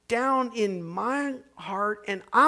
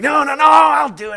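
insistent protest; 6 semitones higher, 9 dB louder than usual. Retold or imitated. Lady insists on moving boxes.

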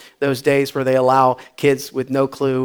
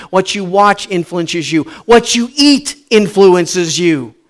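usual speaking voice

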